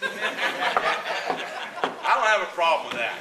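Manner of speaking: loud, slow, laughing through the words; high and loud, cracking up as he talks; laughing trooper. Retold or imitated. Trooper laughing at the fishing scheme, loud and high.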